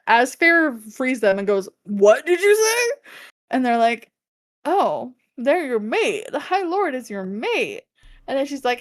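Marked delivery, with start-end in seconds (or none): 1.8-3.1 high, drawn-out shocked question; freeze, then ask it incredulously, pitch climbing; acted shock, higher and slower; stunned disbelief. Feyre freezes at the revelation. 4.6-7.8 slow, swooping singsong announcement; teasing mock-innocent reveal; offhand big reveal. Suriel casually reveals the mate bond.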